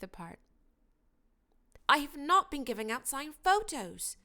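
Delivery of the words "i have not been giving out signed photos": heated denial, higher and louder; hotly. A narrator's reading. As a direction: push back hard on "not". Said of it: "he said hotly".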